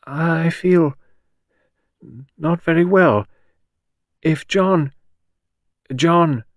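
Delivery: high, halting, strained delivery; broken phrases, struggling to get words out; stiff, unwell. A narrator's reading. Gerda collapsing at the tea table, stiff and faltering. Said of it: "She said stiffly".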